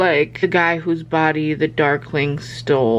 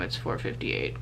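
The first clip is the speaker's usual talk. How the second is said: a whisper, much lower and softer; 12 dB quieter than usual. Real, not acted. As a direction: breathe it out, exhausted. Exhausted late at night.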